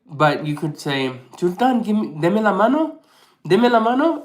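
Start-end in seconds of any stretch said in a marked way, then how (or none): 1.4-4.2 calling the dog, higher and louder; dog command. Retold or imitated. Owner asks the dog for its paw, mock command. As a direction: coax the dog to shake hands.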